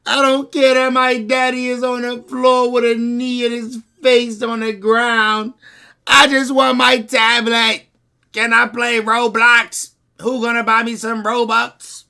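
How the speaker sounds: put-on high, slow whining child begging; mock a kid who only wants his tablet; whiny kid. Mocking the kids in the car, high whiny child voice. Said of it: "All the kids ever want is their tablet".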